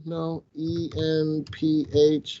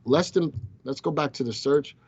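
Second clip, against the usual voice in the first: ordinary talk, nothing marked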